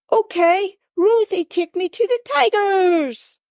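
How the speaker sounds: put-on high, childlike, bossy demand; small boy ordering his sister about; little boy's demand. A narrator's reading. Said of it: "It was a demand".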